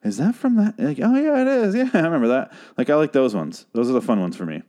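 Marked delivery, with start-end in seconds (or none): 0.0-2.5 much higher, bright pitch jumps into a laugh; squealing delight at a random collectible; excited recognition. Retold or imitated. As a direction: act out spotting an obscure bobblehead and recognizing it. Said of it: "But where it's like".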